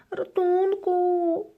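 high, loud, slow scream for help; frightened hamster screaming; terrified scream. A narrator's reading. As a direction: shriek in panic. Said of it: "wrzeszczy przerażony chomik".

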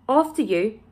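plain reading voice

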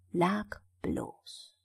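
ordinary delivery, nothing marked

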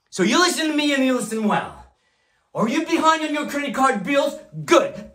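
high, urgent rallying demand; forceful command. Acted. Boss orders indebted brokers to listen, forceful command.

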